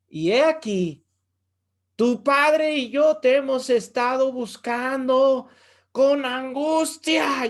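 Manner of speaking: loud, high, slow anxious scolding; mother letting her worry come out hard; Mary scolding her son after three days of searching; speaking harshly. Retold or imitated. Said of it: "Yo me la imagino hablándole duro".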